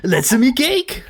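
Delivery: slow high mock French; imitating a French accent, lifting on cake. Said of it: "and she said".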